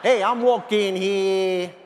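slow, drawn-out New York cat; New York attitude. Acted. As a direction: stretch it out with New York swagger. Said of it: "have a New York attitude".

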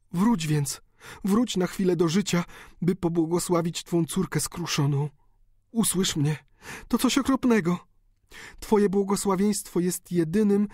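high, tearful pleading daughter; pleading countess. A narrator's reading. Daughter begging her dying father, high and tearful. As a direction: beg the dying father for forgiveness. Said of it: "countess begs dying father".

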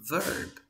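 "verb" stretched out with an E vowel; teacher modelling "verb" with an E sound. Shown as a demonstration. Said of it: "se pronuncian así".

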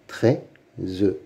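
"Treize" as trei, pause, ze; syllable by syllable. Shown as a demonstration. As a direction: sound out "Treize" with the final e voiced. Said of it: "Lis les mots". (« Treize » découpé en deux, e final prononcé)

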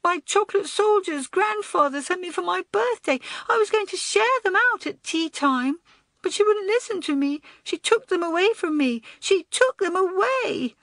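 put-on very high wailing small-boy voice; upset outpouring. A narrator's reading. Small boy tells of his stolen present, wailing. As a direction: pour out a child's tearful grievance. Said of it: "The words came tumbling out".

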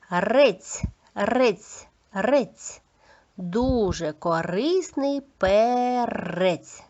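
strong rolled R in "Рець" and "перець"; spaced drill of "Рець", then very slow "перець"; clear articulation. Shown as a demonstration. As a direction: trill the R of "Рець" for pupils to repeat. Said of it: "Чітко вимовляйте, будь ласка, повторюйте".

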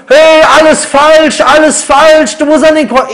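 slow, high mock yell in church; hammed-up heckler shout. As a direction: call it out like a heckler disrupting the sermon. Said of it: "du fängst an zu rufen".

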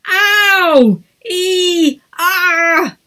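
high, loud yelps of pain. A narrator's reading. Echidnas spiking each other in tent, yelping. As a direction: yelp as the spikes jab. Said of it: "cried Emma".

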